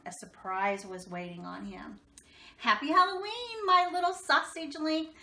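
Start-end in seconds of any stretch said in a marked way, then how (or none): 2.6-5.2 high, loud doting mother; sweet high mom greeting her boy; mother's voice. Acted. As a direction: coo it like a fond mom calling her son. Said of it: "said Oscar's mother".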